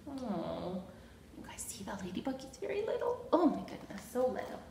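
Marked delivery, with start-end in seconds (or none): none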